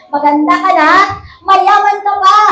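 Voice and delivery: ringing loud declaration, pitch pushed up on the last line; emphatic proclamation. Shown as a demonstration. Preacher proclaims blessing to congregation, loud and emphatic. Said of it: "O, sabihin mo sa iyong katabi".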